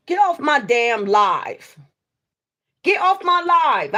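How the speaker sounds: loud, furious get off my live; shouting at someone crashing the stream; angry yell. Real, not acted. Angry at the sister for calling into her live.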